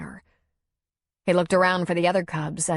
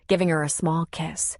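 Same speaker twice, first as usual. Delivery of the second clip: ordinary delivery, nothing marked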